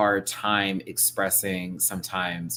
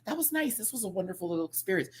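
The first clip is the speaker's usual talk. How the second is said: imitating guests; 12 semitones higher, 4 dB quieter than usual. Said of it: "was like".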